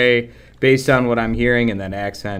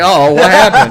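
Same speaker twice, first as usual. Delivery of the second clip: a louder, higher quote broken by laughter; laughing mock concern; 7 semitones higher, 10 dB louder than usual. Co-host retelling his reaction to the glitch, laughing mock concern. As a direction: laugh through a fake worried question. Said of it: "I was like".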